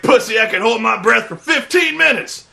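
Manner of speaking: mock tough-guy boast; hammed-up colonel boast. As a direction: brag like a muscle-bound officer. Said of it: "He's like".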